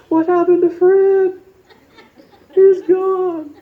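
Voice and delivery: high, loud panicked shrieks over a lost friend; panicked leaves. Pretend. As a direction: scream like a terrified little leaf watching Fred fall. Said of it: "they would be going".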